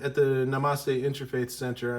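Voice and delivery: ordinary talk, nothing marked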